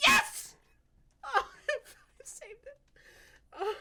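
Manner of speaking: high, loud relieved laugh-cry; laughing through tears. Acted. Elf maker sees her creation live, laughing through tears. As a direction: break into tearful relief.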